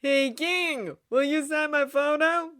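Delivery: high, loud call from the crowd; heckling crowd member. Acted. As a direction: call out to the stage. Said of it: "someone echoes from the crowd".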